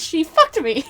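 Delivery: imitating the kid's angry blurt. Student accusing a classmate, said with mock anger. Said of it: "he says it so angry".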